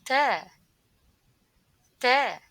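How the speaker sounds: loud, clear Ta; clear teaching model. Shown as a demonstration. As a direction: model Ta firmly. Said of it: "Repeat after me".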